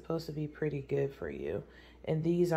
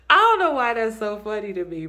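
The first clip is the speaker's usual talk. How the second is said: laughing; 4 semitones higher, 10 dB louder, faster than usual. Real, not acted. Still laughing at the dog.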